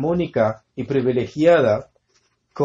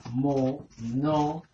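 "monogenes" very slow and softer, a pause after each syllable; 5 dB quieter, much slower than usual. Shown as a demonstration. Preacher introducing a Greek word to the congregation, syllable by syllable. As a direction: split "monogenes" into four separate beats, mo-no-ge-nes.

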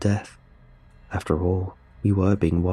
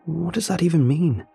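higher-pitched, bewildered reaction; baffled question; 9 semitones higher than usual. A narrator's reading. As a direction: react in puzzled disbelief. Puzzled reaction to cryptic note.

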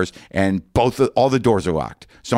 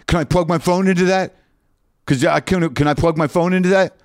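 higher, insistent question; eager questioning; 8 semitones higher than usual. Retold or imitated. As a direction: push urgently for the feature. Asking clerk about the car.

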